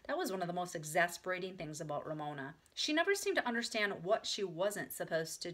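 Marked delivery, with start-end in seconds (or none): none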